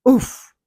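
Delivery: loud pained grunt; pained oof. A narrator's reading. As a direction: grunt at the knee bump. Bumped my knee.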